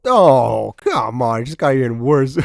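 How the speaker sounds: normal talking voice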